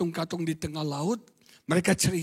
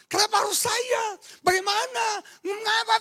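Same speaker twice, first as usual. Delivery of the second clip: put-on arguing child. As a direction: push back like a defiant child. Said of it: "Anak sekarang".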